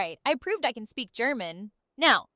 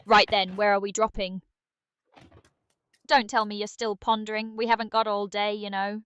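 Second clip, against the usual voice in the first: put-on impatient British accent; brisk British nudge to get moving; British Aria. Game sidekick hurrying the player to drop, impatient British. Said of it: "how was British Aria's day?".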